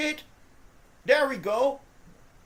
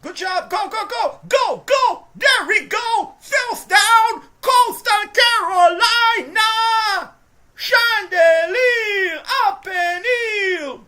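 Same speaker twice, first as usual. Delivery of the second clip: much higher, much louder, slow drawn-out shout; ecstatic cheering; 9 semitones higher, 13 dB louder, slower than usual. Real, not acted. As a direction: scream the play call like a fan. Cheering a big run and score.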